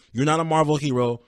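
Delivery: usual speaking voice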